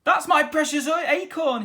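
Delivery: high, loud joyful cry; captain spotting his lost ship; excited cry. A narrator's reading. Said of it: "he cried".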